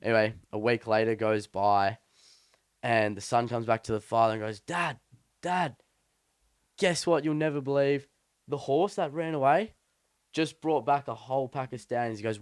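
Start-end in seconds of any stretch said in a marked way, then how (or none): 4.6-12.2 higher-pitched, breaking big news to Dad; excited retold son. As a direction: imitate a son bursting with news. Retold son bringing amazing news.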